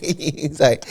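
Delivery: normal talking voice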